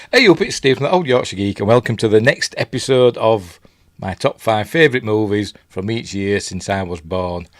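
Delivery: normal talking voice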